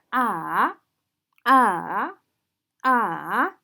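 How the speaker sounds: short fall then long rise on "Ah"; third-tone scooped "Ah"; falling-rising tone. Shown as a demonstration. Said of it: "the third tone falls and rises".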